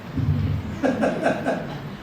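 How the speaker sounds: soft, high chuckle; amused chuckle. Real, not acted. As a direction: laugh lightly at your own joke. Laughs at own acted example.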